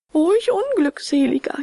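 high-pitched, despairing moan; wailing lament. A narrator's reading. Puppet trapped in the shark's belly, wailing. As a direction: lament in a high, plaintive cry. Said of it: "jammerte er".

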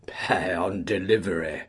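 ordinary delivery

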